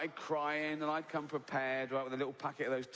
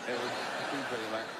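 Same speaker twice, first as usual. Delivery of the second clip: laughing through it; 3 semitones lower than usual. Retold or imitated. Comedian repeating Bob's reply to the vicar, laughing. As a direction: let the laugh break into the line. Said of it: "And Bob went".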